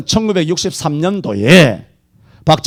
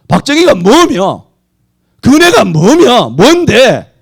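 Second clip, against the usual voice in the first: indignant challenge; 5 semitones higher, 7 dB louder, slower than usual. Real, not acted. Indignant preacher demands answers.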